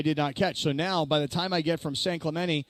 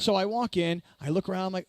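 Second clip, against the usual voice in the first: usual speaking voice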